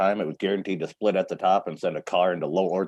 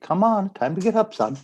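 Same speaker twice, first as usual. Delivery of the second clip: high sing-song wake-up call; dad waking son; 11 semitones higher than usual. Retold or imitated. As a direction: coax him awake, high and light.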